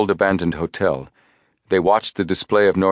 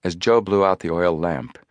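ordinary delivery

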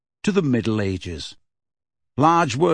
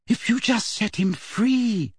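put-on much higher, slow girlish hope; hopeful Hermione; 11 semitones higher, slower than usual. Hermione pleading for the elf, high and hopeful. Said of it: "said Hermione hopefully".

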